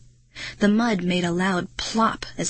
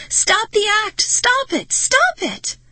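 escalating, very high shriek; furious, hysterical screaming; 14 semitones higher, 5 dB louder, slower than usual. A narrator's reading. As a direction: shriek higher with each demand. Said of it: "I shrieked".

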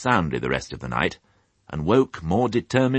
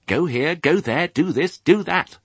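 high squeaky piglet commands; furious squeak; 12 semitones higher than usual. A narrator's reading. Piglet ordering dream sheep about, squeaking furiously. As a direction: squeak out each order crossly. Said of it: "he squeaked furiously at them".

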